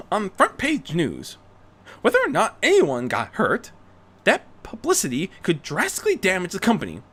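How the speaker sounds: Rarity, urgent plea. Acted. Rarity urging Bruce the Dark Knight must return, high and urgent. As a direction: build the case with conviction.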